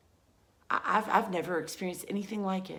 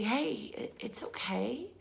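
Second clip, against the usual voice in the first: put-on hushed and tender, higher, like calming a small scared child; soothing whisper; 7 semitones higher than usual. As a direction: whisper comfort gently. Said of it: "I would say".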